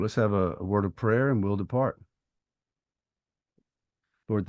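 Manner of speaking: ordinary delivery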